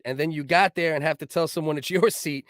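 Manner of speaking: ordinary talk, nothing marked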